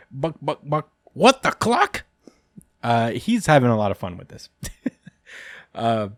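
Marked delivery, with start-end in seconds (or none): 0.1-2.1 squawky high chicken voice, much higher at the end; playful clucking chicken impression; chicken devil voice. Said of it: "Bucky goes".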